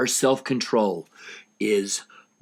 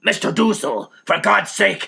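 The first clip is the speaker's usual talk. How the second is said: loud urgent cry to stop; screaming; 8 semitones higher, 6 dB louder than usual. Acted. Mister Frank pulling Dussel off, urgent scream. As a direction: plead him off at the top of your voice. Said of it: "Mister Frank screams".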